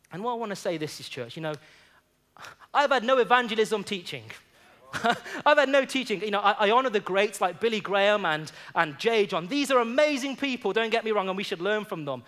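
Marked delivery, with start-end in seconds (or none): 2.7-12.2 louder, rousing sermon delivery; preaching at full force. Acted. Pastor rallying the congregation to evangelize, rousing preaching. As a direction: stir the church to go and witness.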